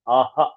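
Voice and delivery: आहा with a breathy echoed ha; visarga demo. Shown as a demonstration. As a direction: visarga echo. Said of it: "ह का उच्चारण आएगा".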